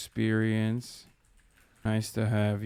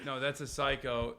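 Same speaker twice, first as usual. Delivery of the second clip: ordinary delivery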